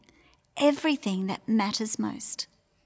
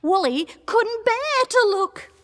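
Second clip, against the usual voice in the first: high-pitched anxious narration; dread-filled storytelling; 11 semitones higher, 4 dB louder than usual. A narrator's reading. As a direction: pitch it up, too frightened to watch. Lamb dreads watching fight.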